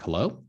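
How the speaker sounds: "Hello?" rising at the end; questioning hello. Shown as a demonstration. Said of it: "it's kinda like a question mark".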